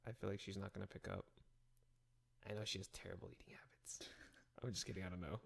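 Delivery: hushed aside while the phone rings; whispering. Real, not acted.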